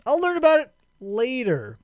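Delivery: high, careless shrug of a voice; breezy dismissive quote. Retold or imitated. Narrator brushes off the mystery, high and careless. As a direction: brush it off for later. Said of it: "he's just like".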